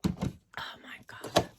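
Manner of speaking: slow breathy whisper under her breath. Real, not acted. Tired morning aside to viewers, slow whisper. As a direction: mutter it to yourself, barely voiced, drawn out. Sighing over the morning coffee.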